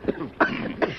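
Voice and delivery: dusty cough. Acted. Coughing from dust.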